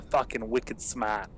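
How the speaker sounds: slow, imitated Boston accent; Boston-speak, drawn out. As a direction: borrow a buddy's Boston accent. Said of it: "Boston accent".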